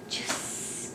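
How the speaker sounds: JUICE in a soft whisper; hushed, voiceless "Juice"; whispered word. Shown as a demonstration. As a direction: whisper "Juice" to a child to copy. Modelling the word for child.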